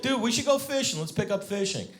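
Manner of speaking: high-pitched imitation of a keen friend; eager buddy. Buddy pitching fishing during pandemic, eager.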